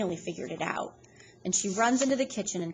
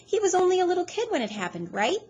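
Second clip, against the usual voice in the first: higher, quicker prattle; high fast gossipy woman; fast chatter; 12 semitones higher, 6 dB louder, faster than usual. A narrator's reading. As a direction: rattle it off eagerly.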